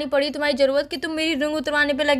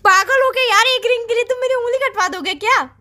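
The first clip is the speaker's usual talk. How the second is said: indignant; 6 semitones higher than usual. Acted. Outraged at finger cutting.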